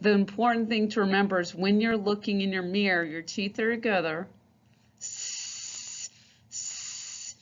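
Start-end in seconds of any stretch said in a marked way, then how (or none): 5.0-7.4 a long unvoiced sss; hissed S sound. Shown as a demonstration. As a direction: hiss through the teeth. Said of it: "forcing air out s".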